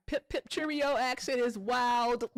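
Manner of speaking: mock British accent voiced slowly and up high. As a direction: tease with a slow mock British lilt. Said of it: "You sound like fish and chips".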